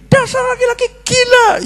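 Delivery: high shrill-pitched mock shriek of an angry wife. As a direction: screech it like a furious wife, high up. Wife screaming at husband.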